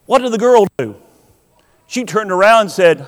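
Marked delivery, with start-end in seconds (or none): none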